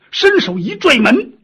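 plain reading voice

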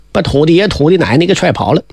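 ordinary delivery, nothing marked

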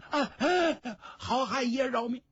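high, panicked cry for mercy; begging in terror. Acted. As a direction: shriek for mercy with a knife at your throat. Old woman begs for her life. (高声惊恐地求饶)

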